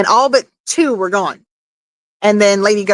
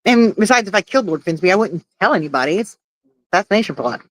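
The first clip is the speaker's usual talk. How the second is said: ordinary talk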